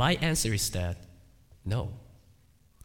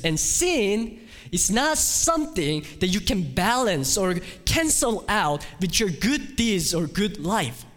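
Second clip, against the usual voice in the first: high, loud sermon delivery; preaching; 10 semitones higher, 4 dB louder than usual. Acted. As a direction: preach with full conviction. Preaching at full force.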